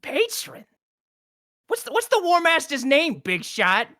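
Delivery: high, challenging goblin; suspicious goblin. Acted. Goblin guard testing the fake agent, high and sneering. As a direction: needle him like a sly goblin.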